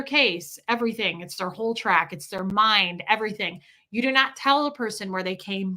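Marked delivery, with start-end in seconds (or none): none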